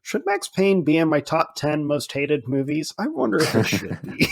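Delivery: normal talking voice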